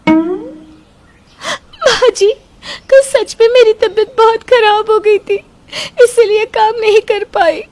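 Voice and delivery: high, tearful, timid begging; fake meek pleading. Daughter-in-law pleads to mother-in-law to fool husband, high tearful timid voice. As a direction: act like a frightened, crying bride.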